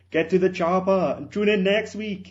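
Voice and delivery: Arnold Schwarzenegger. Retold or imitated. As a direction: bark it like an Austrian action hero. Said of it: "do a better Arnold Schwarzenegger".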